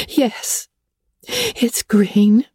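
a lightly voiced moan of despair. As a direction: moan it out in misery. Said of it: "moaned Anne".

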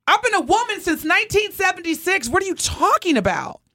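real irritated outburst; indignant. Real, not acted. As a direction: push back hard, offended. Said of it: "'cause now I'm mad".

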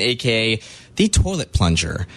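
ordinary talk

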